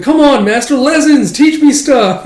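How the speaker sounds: put-on high, loud nagging kid voice; pestering young girl. Young Akane bugging her master for lessons, high and loud. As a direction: pester the master like an eager child.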